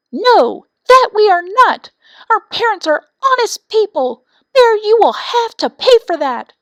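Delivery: high, loud furious screams; angry screaming. A narrator's reading. Young wrens scream at the bear, furious and very high. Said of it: "frightfully angry and screamed".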